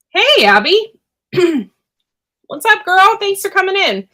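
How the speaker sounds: high, bright hello to a friend; excited greeting. Real, not acted. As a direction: greet her with a big lift. Greeting a viewer in chat.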